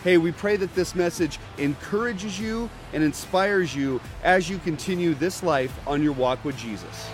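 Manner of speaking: normal talking voice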